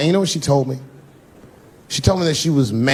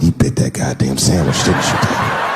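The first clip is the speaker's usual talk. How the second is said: lower, knowing accusation; accusing wife; 11 semitones lower than usual. Retold or imitated. Wife confronts husband about the sandwich, accusing. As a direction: catch him out with a sly jab.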